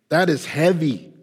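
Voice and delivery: ordinary talk, nothing marked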